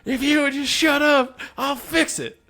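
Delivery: high and loud, hammed-up frustration; mock angry yell. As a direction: bark it like a fed-up drunk friend. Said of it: "And he goes".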